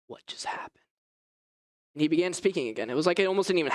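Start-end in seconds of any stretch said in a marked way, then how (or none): 0.1-0.8 breathless whisper of shock; stunned whisper. Real, not acted. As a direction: whisper it in disbelief. Jaw dropped in disbelief.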